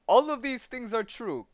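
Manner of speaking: put-on matter-of-fact dog, lightly Russian-accented; mild mock Russian accent. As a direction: concede it with a hint of Russian accent. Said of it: "it's in a Russian accent".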